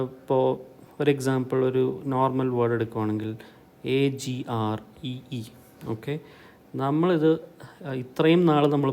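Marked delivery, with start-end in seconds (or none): none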